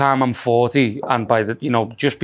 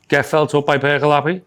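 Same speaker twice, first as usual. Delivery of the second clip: ordinary talk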